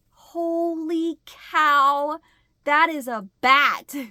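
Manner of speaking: slow, drawn-out and loud dawning shock; stunned realization. Retold or imitated. To herself, realizing it is a bat, slow stunned shout. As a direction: stretch it out as it sinks in.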